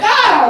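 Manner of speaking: I was like. Retold or imitated. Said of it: "And I was like".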